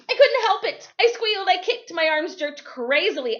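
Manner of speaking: barely containing wild excitement. Acted. Overjoyed at winning.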